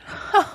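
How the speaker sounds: short high laugh; gleeful laugh. A narrator's reading. Toad laughing at his escape under a hedge. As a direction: burst out laughing. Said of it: "he laughed till he had".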